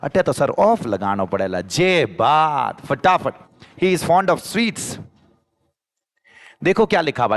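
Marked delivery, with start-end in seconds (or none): 3.8-5.0 high, slow, each word spelled out for the class; slow dictation. Shown as a demonstration. Teacher to Hindi-speaking students, a slow high example sentence. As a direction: read it out slowly so students can repeat.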